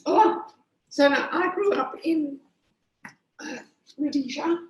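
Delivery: hoarse strained voice from a coughing fit; losing voice. Real, not acted. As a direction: voice cracking after coughing. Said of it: "I've lost my voice".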